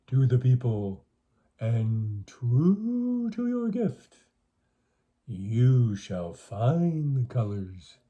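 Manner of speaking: slow, solemn voice from the night; mysterious calling voice. Acted. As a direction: speak slowly like a spirit calling in the dark. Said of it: "he heard a voice calling to him".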